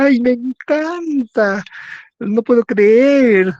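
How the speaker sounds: hammed-up, high-pitched, drawn-out gushing; histrionic drama. Shown as a demonstration. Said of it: "un tono, pues, eh, dramático".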